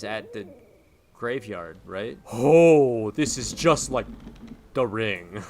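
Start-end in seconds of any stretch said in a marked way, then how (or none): 2.3-5.4 loud excited exclaim. Real, not acted. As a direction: gush over the comparison. Excited horror comparison.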